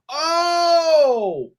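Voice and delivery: loud, high, drawn-out "oh"; dawning realization. Real, not acted. Cohost realizing why his hat matters, loud realization. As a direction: cry out as it suddenly clicks.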